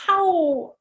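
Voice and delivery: ow right back. Acted. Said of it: "I said right back".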